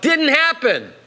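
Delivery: high-pitched heckler's cry; mock outcry. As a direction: call it out high, like an objector in the crowd. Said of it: "cries out".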